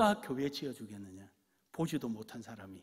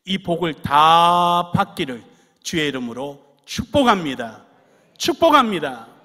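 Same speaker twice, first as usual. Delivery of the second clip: loud benediction; 4 semitones higher, 20 dB louder than usual. Acted. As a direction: bless them with full force. Pastor pronouncing benediction.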